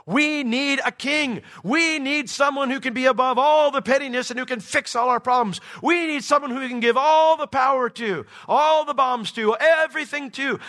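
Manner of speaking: loud, high-pitched mock clamour of the masses; pleading demand. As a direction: cry out like a desperate crowd demanding a ruler. Voices the world demanding a saviour king.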